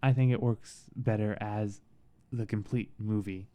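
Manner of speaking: usual speaking voice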